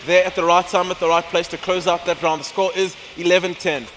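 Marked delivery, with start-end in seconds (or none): 0.0-1.1 esports caster. Acted. Co-caster calls the round live.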